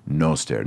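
"noster" with a long O, "NOH-stair". Shown as a demonstration. Latin teacher modelling the right vowel, "noster" with long O. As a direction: round the first vowel of "noster" as in "note". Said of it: "It's a long O".

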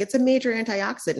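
high, fast mock-excited announcement; hammed-up high, quick mock discovery; mock wellness crowd. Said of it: "and they're like".

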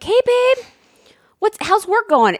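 high, overly sweet wife voice; butter him up innocently; mock-innocent sugary call. Sweet-talking husband on phone.

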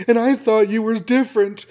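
high hammed-up wounded whine; mock hurt girl. Girl storming off from Peter, hammed-up hurt high voice. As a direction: play Liz running off, high mock hurt. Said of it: "She's like".

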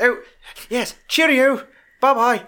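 high, put-on British phone voice; mock British accent. Rob Reiner's character ending a phone call, mock British. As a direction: trill a cheery British goodbye. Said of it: "Hey, fuck, British?".

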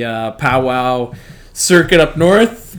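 normal talking voice

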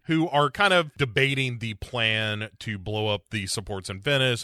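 ordinary talk, nothing marked

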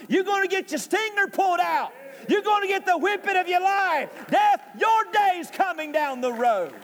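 high, full-force preaching climax; triumphant taunt. Acted. Paul taunting death, preacher's climax.

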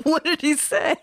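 high laughing question. Real, not acted. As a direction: laugh through the words. Laughing at the punchline.

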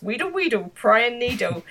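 high, playful chant of a nosy schemer; imitating a scheming inner voice; mock gleeful wheedling. Acted gleeful prying inner thought.

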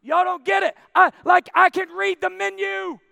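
high, loud, exasperated insistence; yelling. Retold or imitated. Man to whole restaurant about the menu, yelling. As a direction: shout it out to everyone. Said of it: "I'm yelling at the whole restaurant".

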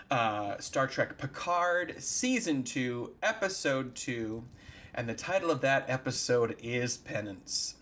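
slow, spaced out episode title; slow announcement. Acted. Host announcing the episode with slow deliberate pacing. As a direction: lay it out slowly like an announcer. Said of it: "announcing the episode".

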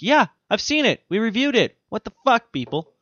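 high, indignant mock outrage; exasperated. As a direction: squeal it, fed up. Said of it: "I'm like".

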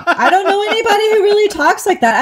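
real amused disbelief; laughing protest. Real, not acted. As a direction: laugh off the request. Asked for a Creole accent.

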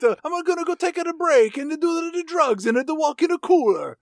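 mock Italian cook calling out in a bad accent; terrible Italian accent. Greasy cook calling to the waitress in a terrible Italian accent. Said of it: "he does have a terrible Italian accent".

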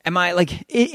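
normal talking voice

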